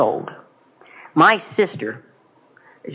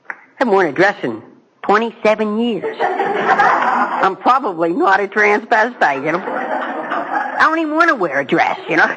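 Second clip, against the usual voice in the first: laughing through the words, higher and slow; 4 semitones higher, slower than usual. Retold or imitated. Speaker laughing at his own dress memory, slow and high. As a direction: chuckle it out, slowly.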